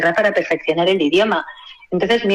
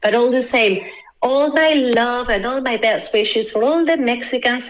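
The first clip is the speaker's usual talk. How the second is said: a heartfelt greeting in European-accented English, slowed; 3 semitones higher than usual. Shown as a demonstration. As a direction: saludo sentido en inglés con acento europeo. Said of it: "con la voz".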